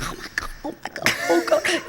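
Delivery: breathless, whispery mock horror; aghast. Comedian acting out shock at the filming, aghast. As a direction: gasp it out, rising to a high squeak. Acts out shocked onlookers on set.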